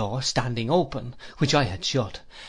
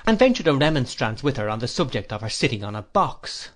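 usual speaking voice

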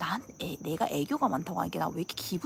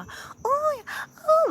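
high-pitched mock squeal; gushing girls' reaction; 5 dB louder, much slower than usual. Girls fussing over each other, mock gushing. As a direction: act out girly cooing, much higher. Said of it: "막 이런 친구들도 거의 없고".

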